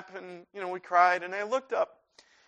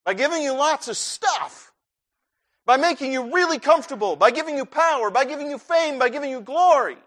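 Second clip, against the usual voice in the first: higher, louder listing of wrong answers; mocking tone, voice raised high; mock worldly blessings; 8 semitones higher, 7 dB louder than usual. Shown as a demonstration. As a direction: rattle them off as the wrong idea, higher and louder.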